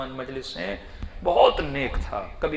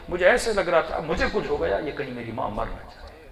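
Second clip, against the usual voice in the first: ordinary delivery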